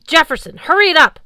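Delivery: high, loud yell to hurry; shout. Acted. Sam shouting at Jefferson to hurry up. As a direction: call out urgently across the field.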